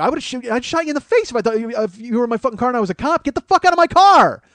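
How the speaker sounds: fast, then a high loud yell; rant, then blast the last line; furious rant building to a shout; angry yell. Retold or imitated. Yelling at woman in car.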